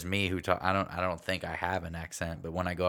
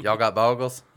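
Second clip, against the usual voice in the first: mock Appalachian mountain speech; mountain dialect; 4 semitones higher, 10 dB louder than usual. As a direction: ask it in a thick mountain accent. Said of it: "like, the mountain dialect".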